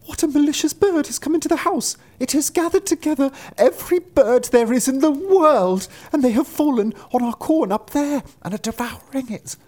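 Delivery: very high, dismayed wife voice; driver's wife. A narrator's reading. Wife telling husband of invading birds, high alarmed voice. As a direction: report the calamity in a high-pitched voice. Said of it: "she answered".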